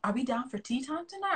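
normal talking voice

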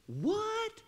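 high, startled squeak of disbelief; shocked what. Retold or imitated. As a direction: jump up in pitch, stunned. Shocked Isaac reacts to dad.

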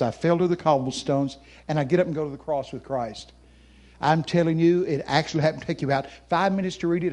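usual speaking voice

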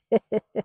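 real, light chuckle; short burst of real laughter; amused chuckle. Real, not acted. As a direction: chuckle to yourself after the remark. Amused by her bat wings remark.

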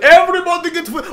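put-on very high, loud Egyptian-accented yell. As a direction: shout over the cashier as an Egyptian customer. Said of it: "just a general Egyptian accent".

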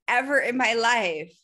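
normal talking voice

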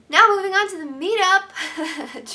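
high, slow, loud showman pivot; mock dramatic switch. After tearing up, she pivots to her news with a big theatrical announcement. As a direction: announce the change of topic like a host. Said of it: "Dramatic change of subject".

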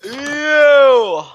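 loud high you; mock accusing shout. Accusing a friend in jest, loud high shout. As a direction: point and yell.

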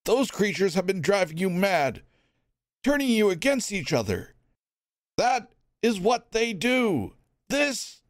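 rally them against the real enemy; urgent Elvin. Acted. Elvin urging the crowd, urgent plea.

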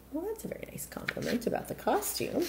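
soft whispered aside. Real, not acted. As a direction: lean in to the listeners and whisper. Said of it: "teller's whispered aside".

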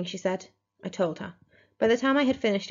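plain reading voice

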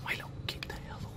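fast hushed whisper. Real, not acted. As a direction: hiss it quickly, hushed. Shooing the dog in a whisper.

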